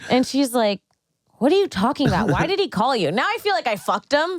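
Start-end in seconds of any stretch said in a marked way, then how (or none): 1.4-3.1 higher, incredulous interrogation; mock demanding. Sister confronting her about the call, incredulous demand. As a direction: act out a suspicious confrontation.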